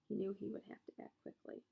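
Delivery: usual speaking voice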